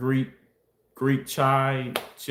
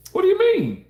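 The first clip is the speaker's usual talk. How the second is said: incredulous; 4 dB louder than usual. Real, not acted. Sudden high incredulous question.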